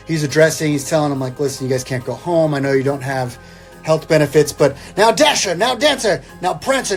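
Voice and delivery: usual speaking voice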